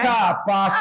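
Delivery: "Capaz" drawn out in two separated syllables; intonation on "capaz". Shown as a demonstration. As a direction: stretch out Ca-paz as an exclamation of disbelief. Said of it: "de acordo com a entonação".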